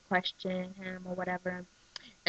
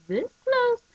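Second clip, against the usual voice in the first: much higher, loud on close; squeaky emphasis; 6 dB louder than usual. Shown as a demonstration. Vlogger showing viewers how close they came, squeaking. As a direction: squeal the word close high. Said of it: "You see this?".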